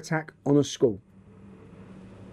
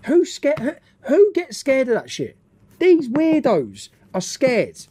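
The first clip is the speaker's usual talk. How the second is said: higher, mocking disbelief; higher, slow scornful rant; incredulous rant; 8 semitones higher, slower than usual. Real, not acted. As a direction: sneer at the fearful believers. Ranting at the news story.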